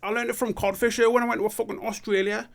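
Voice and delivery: high, cocky mock boaster; put-on voice. Bragging to a friend, high silly put-on voice.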